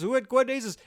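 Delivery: loud, high-pitched mock Indian accent. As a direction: imitate an excited Indian man, high and loud. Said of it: "imitating his accent".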